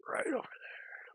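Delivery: slow whispered "over there"; whispered echo. Shown as a demonstration. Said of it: "He was right over there".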